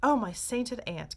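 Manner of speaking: slow, horrified exclamation; hammed-up disgust; mock dismay. A narrator's reading. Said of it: "cried Mister Wonka".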